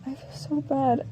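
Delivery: hushed regret; retold guilt. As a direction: say it quietly, feeling bad. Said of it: "I did feel bad".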